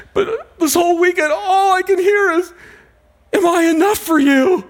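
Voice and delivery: high, tearful and rising, almost breaking; retold crying plea. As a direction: voice a man weeping at the altar. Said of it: "he's just crying".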